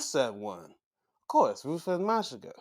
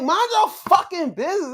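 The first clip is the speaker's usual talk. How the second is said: raised, high, heated yell; angry outburst; 6 dB louder than usual. Real, not acted. Viewer fed up with interference in a good match, angry yell. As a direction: yell it at the meddlers in frustration. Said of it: "I got angry at this".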